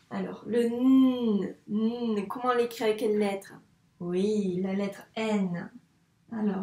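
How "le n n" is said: "n" as a long hum; isolated letter sound. Shown as a demonstration. Teacher asks how this sound is written, sounded out. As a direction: hold the consonant "n" slowly on its own. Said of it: "Donc deux sons".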